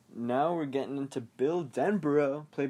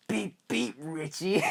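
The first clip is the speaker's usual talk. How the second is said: hammed-up clown taunt, pitched up; Tim Curry's Pennywise; 4 semitones higher, slower than usual. Reviewer mimicking the original clown for listeners, hammed-up impression. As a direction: play a teasing monster clown, breaking into a laugh. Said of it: "he has that deep".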